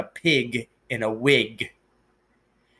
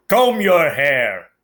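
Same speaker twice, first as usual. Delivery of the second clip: Uncle Vernon's gruff bark, louder and slow; barked order; 6 dB louder, slower than usual. A narrator's reading. Uncle barks at Harry over breakfast, gruff loud bark. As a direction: bark it as a curt morning command. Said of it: "he barked".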